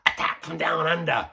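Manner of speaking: imitating a friend's failed New Zealand accent, high and loud; hammed-up New Zealand accent from a Canadian; mock New Zealand accent. Mimicking a buddy's bad New Zealand accent, loud and high. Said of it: "thinking that he's doing, uh, a New Zealand accent".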